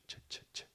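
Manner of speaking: "ch, ch, ch" as bare voiceless puffs; very soft, separate "ch, ch, ch" with no vowel; sound demonstration. Shown as a demonstration. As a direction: isolate the sound in "ch, ch, ch", softly, three times. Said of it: "the j sound".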